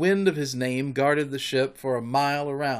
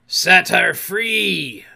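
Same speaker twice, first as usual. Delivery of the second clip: loud, drawn-out command; imperious pirate captain's call; Captain Hook; 5 semitones higher, 8 dB louder, much slower than usual. A narrator's reading. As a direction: shout the order slowly and high. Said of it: "came the astonishing answer".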